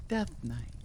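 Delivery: ordinary talk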